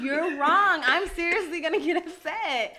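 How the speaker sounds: high, loud irritated complaint; annoyed protest. Real, not acted. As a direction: push back hard, half laughing. Annoyed at being called out.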